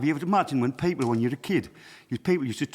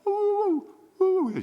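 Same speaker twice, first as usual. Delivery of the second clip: put-on high ghostly ooh to frighten a kid; spooky ghost hoot; slower than usual. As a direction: wail like a ghost behind the door. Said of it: "she'd keep going".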